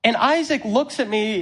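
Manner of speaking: ordinary talk, nothing marked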